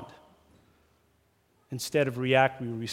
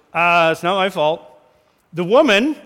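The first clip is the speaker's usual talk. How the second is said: defensive Adam; 2 semitones higher, 6 dB louder than usual. Retold or imitated. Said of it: "And Adam goes".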